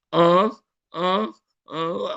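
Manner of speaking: high mock groans; mock moaning. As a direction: moan in mock despair. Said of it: "jangan kamu merintih". (rintihan pura-pura, tinggi)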